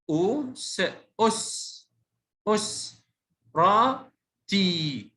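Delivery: أس، را، تي as separate syllables with gaps; spelled out. Shown as a demonstration. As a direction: sound out أسرتي piece by piece. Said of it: "تهجأ".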